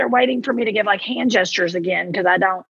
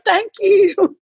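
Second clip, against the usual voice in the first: high grateful thank you; 11 semitones higher, 4 dB louder than usual. Retold or imitated. Retold grateful reply.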